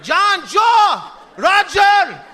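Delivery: put-on high, loud hollering for the kids; mock dad shout, drawn out at the end; dad yelling. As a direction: holler names across the house as dad. Dad yelling sons' names, muddled.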